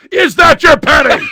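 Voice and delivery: loud, insistent demand; impatient shout. Retold or imitated. Magician shouting at his conscious partner, insistent shout. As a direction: bark the question at him. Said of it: "And Teller said".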